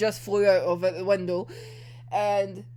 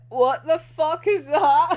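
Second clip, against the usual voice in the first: laughing disbelief; 4 semitones higher, 6 dB louder, slower than usual. Retold or imitated. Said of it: "I was just like".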